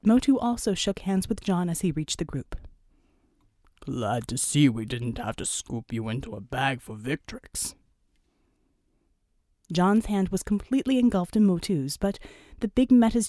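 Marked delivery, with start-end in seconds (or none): 3.8-7.7 very low giant's greeting; huge Samoan brother. Acted. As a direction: play the enormous gentle islander, pitched right down. Huge Motu greets John.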